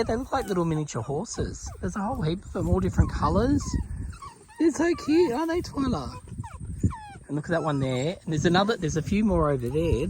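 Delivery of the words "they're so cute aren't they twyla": pet voice. Real, not acted. Cooing at the ponies and dog.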